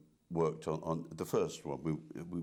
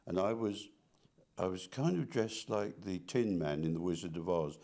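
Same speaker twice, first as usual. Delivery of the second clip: ordinary delivery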